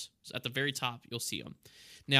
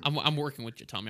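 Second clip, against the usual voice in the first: ordinary talk, nothing marked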